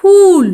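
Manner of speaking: फूल loud, high, ऊ held long; pronunciation demo. Shown as a demonstration. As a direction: stretch the ऊ in फूल. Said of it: "कैसे उच्चारण करना है".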